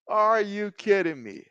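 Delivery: ordinary delivery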